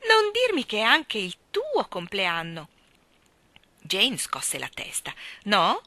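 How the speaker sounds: a merry, chatty uncle explaining his trouble; bubbly old gentleman telling his secret; jolly Mr Wigg. Acted. Mr Wigg voiced in story.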